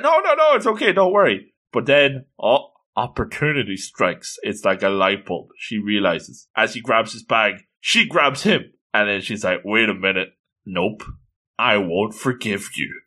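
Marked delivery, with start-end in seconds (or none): 0.0-1.5 high-pitched, flustered reassurance; hurried reassurance. Acted. Girl telling the apologizing boy it's okay, high and flustered. 9.6-13.0 low, understated scheming; devilish. Acted. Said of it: "devilish smile on her face".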